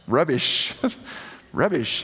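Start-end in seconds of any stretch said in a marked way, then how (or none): 1.5-2.0 a light, laughing stab at an English accent. Shown as a demonstration. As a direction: say it like a Brit, amused at yourself.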